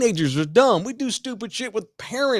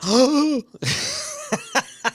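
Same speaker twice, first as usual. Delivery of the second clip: high, drawn-out imitated gasp; sharp intake in mock shock; mock startled gasp; 11 semitones higher than usual. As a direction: gasp like someone caught red-handed.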